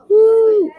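high loud whoop of joy; excited whoop. Acted. Brother whooping as he rides the slide, excited. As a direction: let out a thrilled whoop.

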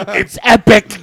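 loud, drawn-out fake-epic announcement; mock hype.

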